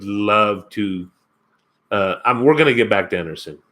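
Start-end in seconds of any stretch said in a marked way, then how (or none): none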